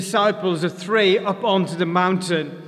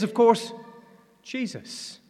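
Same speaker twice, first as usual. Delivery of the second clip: ordinary talk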